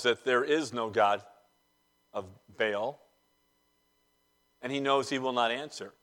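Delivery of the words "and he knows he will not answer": ordinary talk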